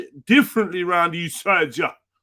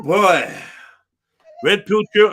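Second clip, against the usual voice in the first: ordinary talk, nothing marked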